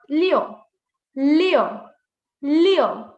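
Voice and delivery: LIU ending in an open o, like "lyo"; correct LIU vowel. Shown as a demonstration. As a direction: open your mouth on the end of LIU. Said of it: "Oh, open your mouth".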